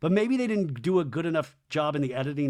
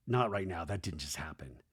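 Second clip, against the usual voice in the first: low, much softer reply; muted brush-off; 10 semitones lower, 9 dB quieter than usual. Retold or imitated. Wife puts Danny off for now, low muted brush-off. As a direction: imitate a hushed, private dismissal.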